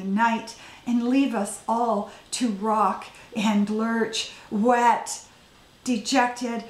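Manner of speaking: deliberate recital, lingering on stressed words; poetry reading. Acted. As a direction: read it as verse, weighting each image. Poet reading own poem aloud.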